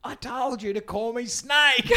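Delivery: mock indignant dad. Telling how Snake scolds his kid, slow mock indignation. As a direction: insist on the nickname like a wounded ego. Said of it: "He's like".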